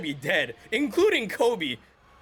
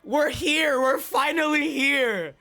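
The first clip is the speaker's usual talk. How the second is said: excited shout; 5 semitones higher, much slower than usual. Real, not acted. Fan finally seeing the big moment, a slow high shout. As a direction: let it burst out slowly, then keep cheering. Climax of the fight.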